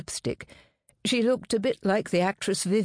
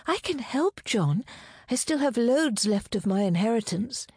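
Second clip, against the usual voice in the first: put-on a touch of a higher-pitched young lover; devoted fiancée, pitched up a little; lightly voiced eager fiancée; 6 semitones higher than usual. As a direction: lightly lift the voice, eager to help.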